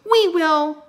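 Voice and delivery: high, very loud volunteer's cry; shouted. A narrator's reading. Girl volunteers before the knights can, shouted. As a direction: shout it out eagerly. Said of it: "shouted Annie".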